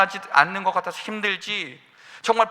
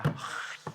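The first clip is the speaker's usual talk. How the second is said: sigh; 11 dB quieter than usual. Retold or imitated. Preacher stuck writing his sermon.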